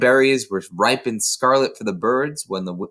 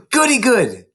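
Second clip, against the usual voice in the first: put-on thrilled little girl cheering; high happy child burst; delighted gasp; 11 semitones higher, 6 dB louder than usual. A narrator's reading. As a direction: gasp then squeal with glee, louder. Matilda delighted.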